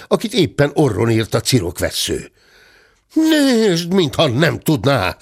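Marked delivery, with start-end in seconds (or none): 3.1-5.2 high, scornful outburst; shrieked reproach that eases at the end; market woman snapping. A narrator's reading. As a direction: round on them like a bread seller. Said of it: "rivalt rá a kenyeres kofa".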